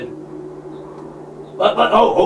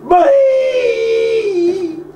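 higher, loud cry of the name; shocked call; 10 semitones higher, 3 dB louder than usual. Acted. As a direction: cry out her name in alarm. Barends calls out the girl's name.

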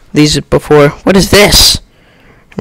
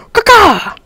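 high mock crow call; crow caw. Imitates a bird.

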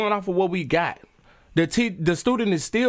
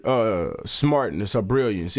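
mocking sarcasm; 7 semitones lower than usual. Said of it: "She keep throwing around her".

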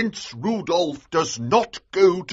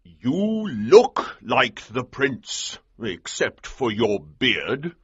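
slow, drawn-out colonel voice; 5 semitones higher, slower than usual. A narrator's reading. Colonel sizing up the hero as double, said slowly. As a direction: weigh each word slowly as the colonel. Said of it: "Colonel Sapt said slowly".

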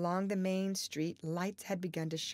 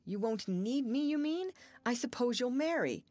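high, pained questioning; a hurt, higher-pitched retort; hurt challenge; 7 semitones higher than usual. A narrator's reading. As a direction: press her with wounded disbelief. Hurt lover challenges her.

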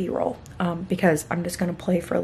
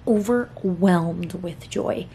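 slow, sarcastic; much slower than usual. Shown as a demonstration. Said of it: "I too was overwhelmed with joy".

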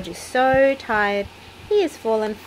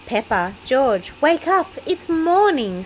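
bright wake-up call; 7 semitones higher, 4 dB louder than usual. A narrator's reading. Mummy Pig wakes the children brightly. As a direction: sing out a cheerful good morning. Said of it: "says Mummy Pig brightly".

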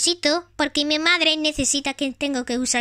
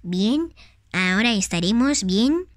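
mother toy voice; 8 semitones lower than usual. Pretend. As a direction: play the gentle mother of Tag. Plays Tag's mother.

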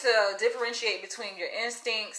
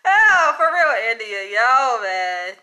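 laughing exclamation; 8 dB louder, slower than usual. Real, not acted. Woman responding to a viewer after cracking up, loud and laughing. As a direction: get it out through the laughter.

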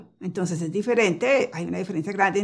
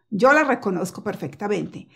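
normal talking voice

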